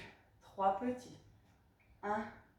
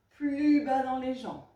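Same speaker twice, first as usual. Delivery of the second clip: emphatic coaching call; 8 dB louder, slower than usual. Shown as a demonstration. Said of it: "coach pushing the squat".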